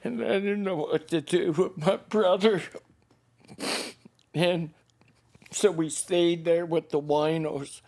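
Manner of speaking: high, choked up, long halting pauses; real tearful voice. Real, not acted. Man remembering sheltering with his brother, crying. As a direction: push the words out through sobs. Keeps telling through tears.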